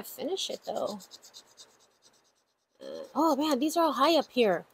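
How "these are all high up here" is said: usual speaking voice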